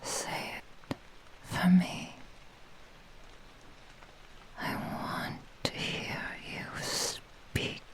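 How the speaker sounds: very soft, voiceless whisper with long pauses; intimate whisper. Acted. Kitsune tells her captive her name, whispering intimately. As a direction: breathe it out like a secret incantation.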